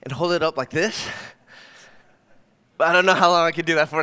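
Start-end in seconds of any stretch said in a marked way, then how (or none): 2.8-4.0 fast, loud, strained under a weight; straining under the weight, rushed and loud; strained while holding weight. Shown as a demonstration. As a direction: push the words out while arms hold up a heavy weight.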